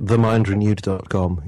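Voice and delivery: usual speaking voice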